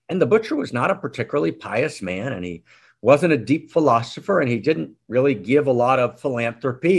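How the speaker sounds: normal talking voice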